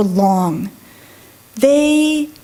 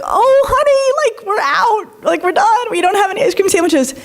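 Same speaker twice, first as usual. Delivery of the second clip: sugary staff voice; 8 semitones higher than usual. Retold or imitated. Ice cream server tells a mom they're out, high and sugary. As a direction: coo it like a sing-song server breaking bad news. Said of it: "women are like".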